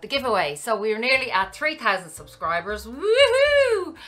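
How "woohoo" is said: high, loud celebratory whoop; excited cheer. Real, not acted. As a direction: cheer for the subscribers. Cheering nearing subscriber goal.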